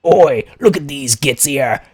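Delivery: slow, gruff-ish orc that drifts toward a pirate; a hesitant try at a green-skinned orc grunt; orc voice attempt. Chat asks for an orc voice, a slow half-pirate orc try. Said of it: "That was more of a pirate voice".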